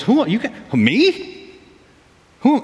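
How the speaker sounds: high, disbelieving who-me; mock Moses protesting God's call; incredulous Moses. Pastor voicing Moses when God calls him, mock disbelief. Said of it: "he says when God calls him".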